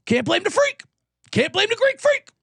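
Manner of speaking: high, far-off catchphrase voice; mock catchphrase. Catchphrase variant, high pitch.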